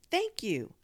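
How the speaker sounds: excited shouted thank you. Acted. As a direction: shout it down the phone. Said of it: "I shouted into the phone".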